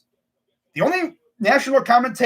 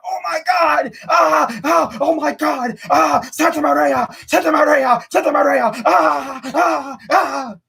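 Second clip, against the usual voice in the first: high, frantic repeated cries; going crazy; 7 semitones higher, 4 dB louder, slower than usual. Acted. Fan imagining commentator after a Series walk-off, going crazy. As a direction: shriek over and over like a berserk announcer. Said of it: "going crazy".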